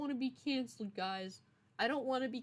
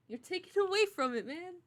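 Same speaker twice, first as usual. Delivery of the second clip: high, loud whiny complaint; mock whine; 9 semitones higher, 5 dB louder than usual. Streamer whining at chat for derailing story. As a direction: whine it like a kid robbed of the spotlight.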